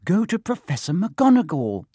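high, insistent urging. A narrator's reading. Girl pushes bullied friend to tell a teacher, urgently. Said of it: "Hermione urged Neville".

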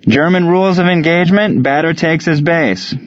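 high, announced ruling; umpire's call. A narrator's reading. Umpire sending the German batter to base, calling out. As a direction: announce it to the field in a lifted voice.

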